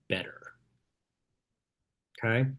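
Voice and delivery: "better" whispered, low and soft; stage whisper. Real, not acted. Coach telling team they're better, whispered. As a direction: drop "better" to a secret whisper.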